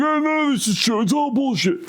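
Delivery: high, scoffing mock atheist voice. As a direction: sneer it like a know-it-all skeptic. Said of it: "the atheists are like".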